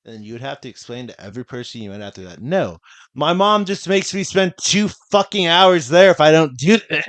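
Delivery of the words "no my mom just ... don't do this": pushed-to-the-edge plea; exasperated outburst. Retold or imitated. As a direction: vent it like you're fed up. Exasperated quoted explanation.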